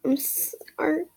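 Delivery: slow, tearful whisper; small whispered sorry; whispered apology. Acted. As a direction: whisper it through tears. Crying character apologizes.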